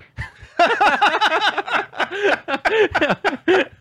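real burst of high laughter; big laugh. Real, not acted. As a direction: crack up at the punchline. Friend's punchline lands.

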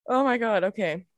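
ordinary delivery

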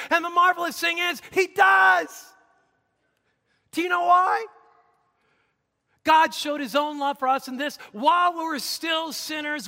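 forceful sermon voice building to a shout; impassioned preaching. Real, not acted. Preacher tells congregation God forgives sinners, impassioned. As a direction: proclaim it to the congregation with rising fervour.

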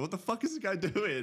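high, laughing, incredulous voice of the client; imagine the client baffled, laughing through it; amused imitation of a baffled buyer; laughing mock bewilderment. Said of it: "it's probably like".

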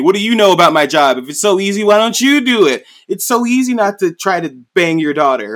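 hammed-up furious king; angry king. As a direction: rant at him like a wounded king. King voiced angrily in retelling.

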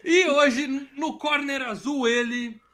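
booming ring announcer intro; brash fight promoter hype. Acted. Podcast hosts introduced like boxers.

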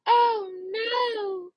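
high, drawn-out wail; long high-pitched lament; dismayed. Real, not acted. Realizes packaging mistake.

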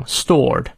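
"stored" with a drawn-out "or" vowel; slow "stored", vowel held long. Shown as a demonstration. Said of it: "拉长stored".

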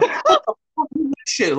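high, incredulous mock outburst; mock outraged shout. Answering scammers' threats, hammed-up outrage. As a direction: fire it back, outraged and hammed-up.

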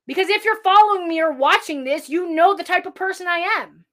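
ordinary talk, nothing marked

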